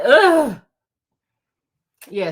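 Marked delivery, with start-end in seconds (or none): 0.0-0.6 loud groan of disgust at vacuuming; a short fed-up ugh. Retold or imitated. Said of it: "I be like".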